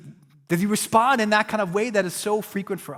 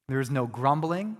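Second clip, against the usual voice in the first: ordinary talk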